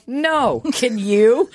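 high, laughing "no, can you?"; teasing laughing comeback; laughing retort. Retold or imitated. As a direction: fire it back while cracking up. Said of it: "I go".

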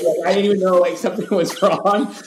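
ordinary talk

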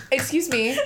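cracking up while acting the line; laughing. Real, not acted. Telling how she confronted the girl, laughing helplessly. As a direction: fight through real laughter to say it. Breaks up laughing mid-story.

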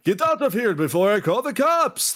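gruff hunter voice. Acted. GM voices the hunter ordering the vampire out. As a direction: play the edgy hunter, shooing him off. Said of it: "I'm done with the voice".

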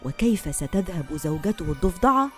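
usual speaking voice

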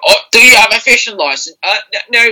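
high, comic British copper questioning someone; British policeman from meme. Retold or imitated. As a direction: act out the meme in a hammed-up bobby voice. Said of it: "there's a video of, like, a guy".

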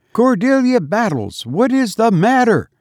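high-pitched, urgent demand; alarmed cry. A narrator's reading. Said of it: "cried Missus Townsend".

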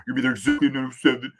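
fast, put-on mocking voice; mock incredulous. Friend reacting to overly exact time, mock incredulous. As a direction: rattle it off, ridiculing the exactness. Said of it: "That's how those people talk".

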